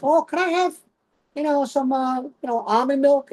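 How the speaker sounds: put-on high customer voice; high-voiced polite request. Customer asks Dunkin' for almond milk, high-voiced request. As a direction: pitch it way up, asking hesitantly. Acting out a customer ordering coffee.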